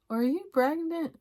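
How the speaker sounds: surprised question. Retold or imitated. Boyfriend asks her about being pregnant, surprised question. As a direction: mimic an astonished boyfriend asking.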